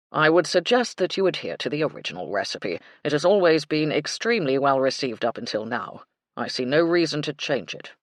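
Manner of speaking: proper butler advising; stiff butler. A narrator's reading. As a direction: lay down the rule with cool courtesy. Baxter's voice.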